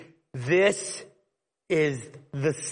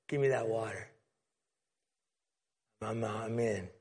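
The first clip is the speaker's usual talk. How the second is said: much softer, lower voice; softly voiced woman; quiet eager plea; 7 semitones lower, 9 dB quieter than usual. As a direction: murmur it, keen but hushed. Woman asks for water.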